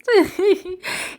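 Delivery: bursts into a high laugh; a real high laugh. Real, not acted. Laughing at her own bit.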